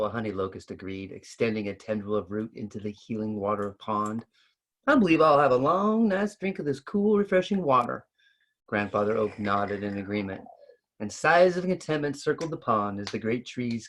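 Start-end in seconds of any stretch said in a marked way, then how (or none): 4.9-8.1 a lightly raised, contented voice; higher, pleased tree voice. A narrator's reading. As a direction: savour it like a cool drink. Honey locust savours drink.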